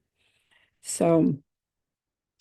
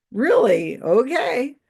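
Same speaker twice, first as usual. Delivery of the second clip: sceptical reaction; 6 semitones higher, 4 dB louder, much slower than usual. Retold or imitated. Acts out her sceptical inner reaction.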